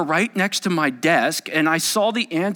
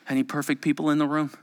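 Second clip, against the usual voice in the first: ordinary delivery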